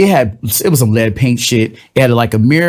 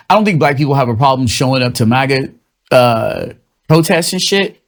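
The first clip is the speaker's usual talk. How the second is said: ordinary talk, nothing marked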